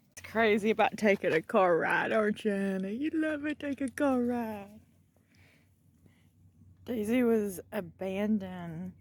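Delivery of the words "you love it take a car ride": pet talk. Real, not acted. Telling Daisy she loves car rides, lightly higher coo. Cooing to dog about car ride.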